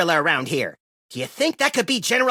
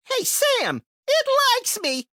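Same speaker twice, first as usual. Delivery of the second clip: much higher, giddy and pleased; delighted. Acted. Max telling Sam the creature likes him, giddy. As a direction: gush like a kid with a new pet.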